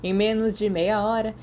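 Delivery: usual speaking voice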